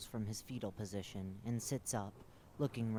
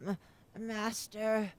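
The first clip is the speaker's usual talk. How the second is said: high, stammering terrified whimper; terrified creature begging its master; abject terror; 11 semitones higher, 6 dB louder, much slower than usual. Acted. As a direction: cower before your master. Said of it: "words are filled with abject terror".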